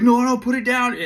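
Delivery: loud, high, urgent begging; panicked pleading. Retold or imitated. The squad begging T to put the grenade down, loud and panicked. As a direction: talk him down in a frantic rush.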